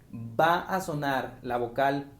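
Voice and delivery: ordinary delivery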